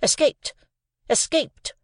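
sharp, high, snapped-out answer; snappish. A narrator's reading. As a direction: snap it at the boy, impatient. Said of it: "said snappishly".

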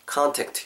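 contact pronounced "KAN-tekt"; open-vowel contact. Shown as a demonstration. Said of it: "모음을 아 정도 가깝게 해서". ('칸'처럼 '아'로 여는 contact)

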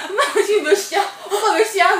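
high, loud impression of a fretting bride; urgent bride. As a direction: call it out in mock panic. Bride fretting about late wedding start.

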